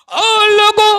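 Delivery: ओ लोगों called out high and loud, long vowels; calling out loud. Shown as a demonstration. Preacher calling out to the congregation, loud high call. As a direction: shout ओ लोगों to the whole crowd. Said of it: "repeated call louder".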